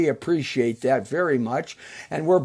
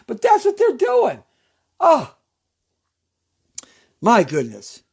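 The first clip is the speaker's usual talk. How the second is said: exasperated; 6 semitones higher, 3 dB louder than usual. Real, not acted. Exasperated rant about Congress.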